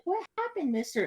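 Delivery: put-on very high, sweet little-girl voice; imitating a nosy girl, high and sweet; mock little girl voice. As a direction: play the nosy innocent kid asking.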